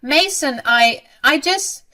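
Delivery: high, loud, halting plea; flustered stammer. A narrator's reading. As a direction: stammer as if caught out. Caught mother stammers to angry son.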